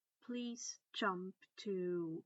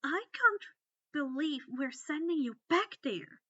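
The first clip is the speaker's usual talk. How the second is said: higher, louder frustrated outburst; frustrated growl; 6 semitones higher, 8 dB louder than usual. Acted. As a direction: vent her frustration at the unfairness. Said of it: "Hermione growled in frustration".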